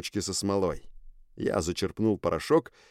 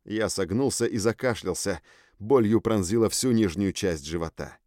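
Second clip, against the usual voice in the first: ordinary delivery, nothing marked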